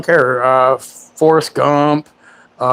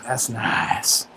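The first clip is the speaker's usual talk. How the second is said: breathy whisper of pleasure; soft whispered approval; 3 semitones lower, 9 dB quieter than usual. Real, not acted. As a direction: murmur it softly, pleased. Savoring the smooth chair.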